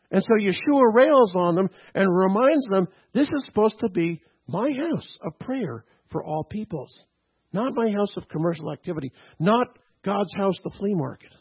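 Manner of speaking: rising preacher's rebuke; preaching. Acted. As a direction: drive the rebuke home from the pulpit.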